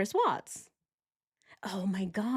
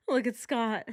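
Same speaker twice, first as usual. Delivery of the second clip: normal talking voice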